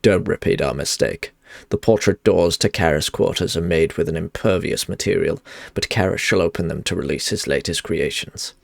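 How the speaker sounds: low, even reading of a quest giver's plea; game dialogue read. Acted. Streamer reading a quest NPC's lines to viewers, low and steady. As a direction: read the NPC's warning steadily, a touch solemn.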